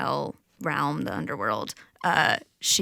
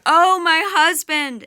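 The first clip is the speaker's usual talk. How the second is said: high, loud grief-stricken wail, drawn out; wailing cry; 11 semitones higher, 11 dB louder than usual. Acted. Goddess in the underworld wailing for her husband, loud keening wail. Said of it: "she's crying out".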